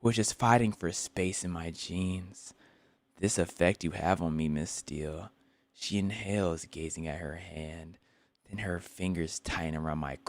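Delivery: soft, slow novel reading; book reading. Acted. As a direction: read the passage quietly. Continues reading.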